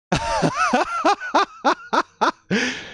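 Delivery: long, high staccato laughter; giddy laugh. Real, not acted. Fan overjoyed by the pick, giddy laughing. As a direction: laugh in a high rapid string.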